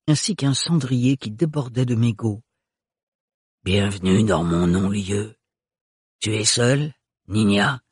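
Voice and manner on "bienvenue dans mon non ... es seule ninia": put-on guttural old woman. A narrator's reading. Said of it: "avec son accent très caractérisé".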